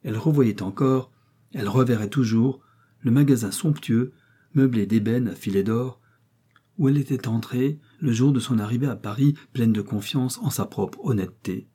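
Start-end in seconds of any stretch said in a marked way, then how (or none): none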